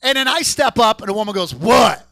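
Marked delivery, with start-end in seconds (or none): 1.5-2.1 one loud, abrupt demand; acted brusque greeting to a customer; blunt counter worker. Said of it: "It's very blunt".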